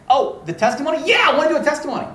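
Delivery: high, loud, excited exchange; imitated eagerness. Student asking to give her testimony, mock-eager and loud. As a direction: act out someone seizing on the word.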